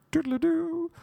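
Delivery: a high, thin imitated flute toot; mock flute toot.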